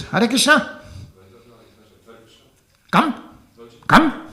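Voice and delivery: high, loud commanding calls; bossy calling. Retold or imitated. Rajasthani lady calling the young monk in, loud and bossy. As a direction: summon him like a market matron.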